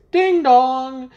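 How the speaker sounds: high, bright ding dong; doorbell chime. Retold or imitated. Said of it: "that noise".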